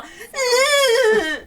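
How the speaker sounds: loud high whining groan; whiny cry shout. Retold or imitated. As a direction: whine like a defiant kid. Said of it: "and she's like".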